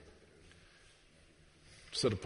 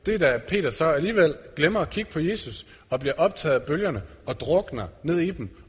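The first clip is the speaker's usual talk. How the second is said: ordinary talk, nothing marked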